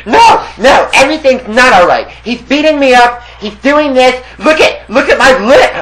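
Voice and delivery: high, loud panicked complaining; frantic neighbor. Retold or imitated. Beaten Russian neighbor showing his lip, high and frantic. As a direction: whine it high and loud like he's hurt.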